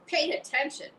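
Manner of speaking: screaming. Acted. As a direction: shriek the order. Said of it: "screamed Wilbur".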